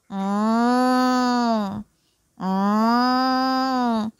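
low, long closed-mouth moo; cow mooing. A narrator's reading. Cow outside the gate seeing the girl, mooing. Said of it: "mooed when it saw".

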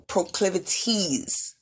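proclivities said slowly and clearly; slow enunciation. Shown as a demonstration. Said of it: "Enunciation is important".

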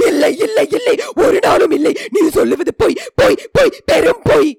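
high, frantic cry of denial; full-force outburst of refusal, very loud and high; loud shouting. A narrator's reading. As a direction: shout it out in anguish, pitch leaping. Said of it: "என்று உரக்க கத்தினான்".

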